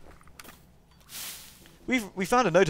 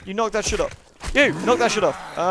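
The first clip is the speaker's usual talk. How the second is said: higher-pitched annoyed telling-off; scolding; 5 semitones higher, 4 dB louder than usual. Real, not acted. Player telling game enemies off, annoyed scolding. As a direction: call them out, louder on the repeat.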